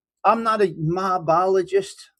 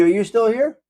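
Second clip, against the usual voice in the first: ordinary talk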